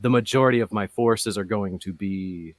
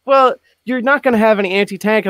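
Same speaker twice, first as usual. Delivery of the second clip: high, loud mock-objector voice; exaggerated, high-pitched impression of critics; imitating objectors; 13 semitones higher, 10 dB louder than usual. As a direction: pitch it way up to mimic naysayers. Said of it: "more people be like".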